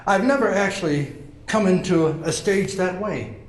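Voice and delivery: normal talking voice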